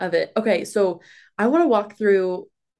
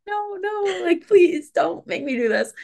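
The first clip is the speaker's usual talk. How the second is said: high, drawn-out mock plea; playfully acted reluctance, pitch way up; panicked pleading; 12 semitones higher, slower than usual. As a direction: beg off in a squeaky little panic. Acting out dread when put on spot.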